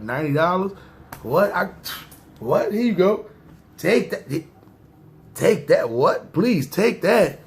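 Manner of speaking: high-pitched, incredulous relief, acted out; hammed-up eager handover, stretching the final plea; eager disbelief. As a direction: practically beg him to take the money. Acting out eagerly handing over ninety dollars.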